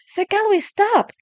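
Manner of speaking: high, urgent call; urgent plea. A narrator's reading. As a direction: beg him to stop. Rushing to stop the knight.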